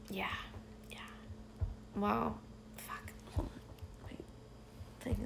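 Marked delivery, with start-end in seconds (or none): none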